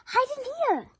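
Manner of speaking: high, loud urgent call; crying out. A narrator's reading. Third pig urging brothers inside, loud call. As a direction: yell to the brothers. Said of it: "cried the third little pig".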